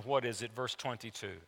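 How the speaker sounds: ordinary delivery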